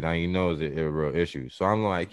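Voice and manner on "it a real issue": ordinary delivery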